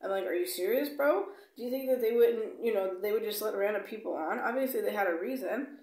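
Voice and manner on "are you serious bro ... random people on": high, loud "bro" of disbelief; indignant retort to the gate agent, higher and louder. Retold or imitated. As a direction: push back at the agent, outraged.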